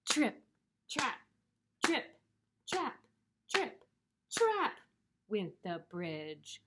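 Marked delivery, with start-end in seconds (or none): 0.1-4.8 high, crisp trip-trap; spaced hoofbeat words, climbing higher; second goat's footsteps. Acted. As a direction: step it out in a high voice.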